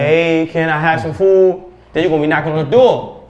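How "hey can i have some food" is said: slow, drawn-out plea for food; more insistent request. Acted. Hungry man's asking progresses toward knocking, insistent. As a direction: press the request, stretching the words. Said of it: "it's gonna progress".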